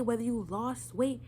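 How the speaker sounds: ordinary talk, nothing marked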